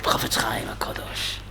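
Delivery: hushed, breathed-out name of a saint; reverent whisper. Real, not acted. Rabbi telling the class a sage's name, reverent whisper. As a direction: whisper the holy name with awe. Reverent naming of holy sage.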